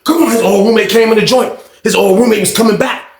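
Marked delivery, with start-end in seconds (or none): 0.0-0.3 higher eager call; fired-up shout. Retold or imitated. John calls the predator back in, fired-up shout. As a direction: shout him on, ready. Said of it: "John was like".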